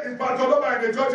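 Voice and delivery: usual speaking voice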